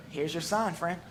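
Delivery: ordinary talk, nothing marked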